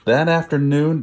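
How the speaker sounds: ordinary delivery, nothing marked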